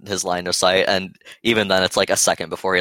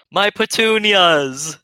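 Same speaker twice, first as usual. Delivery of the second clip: high slow anguished wail; 12 semitones higher, 6 dB louder, much slower than usual. Pretend. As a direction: wail it out in despair. Player wails over ruined flowers.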